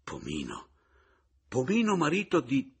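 incredulous, faltering question; stammered disbelief. A narrator's reading. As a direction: repeat it stunned, then blurt it and break off.

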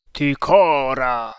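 loud, long-drawn call; mysterious voice crying out; cried out. A narrator's reading. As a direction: call the name out slowly and loudly. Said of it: "gridò una voce".

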